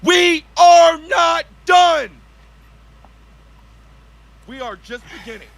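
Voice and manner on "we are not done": slow rallying shout. Acted. Speech climax.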